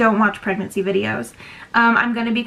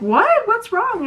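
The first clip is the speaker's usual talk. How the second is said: high, concerned mock mom voice; a much higher, worried mother asking what's wrong; imitating worried mom; 12 semitones higher, much slower than usual. Mom asking her crying daughter, worried high voice.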